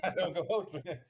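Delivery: a laugh breaking into the words. Real, not acted. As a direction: giggle through the start of it. Laughs mid-sentence.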